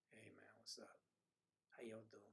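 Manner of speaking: very soft, low "hey man" voice; put-on greeting. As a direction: drop it low and barely voice the greeting. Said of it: "I feel like I gotta go".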